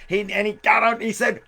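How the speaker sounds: ordinary delivery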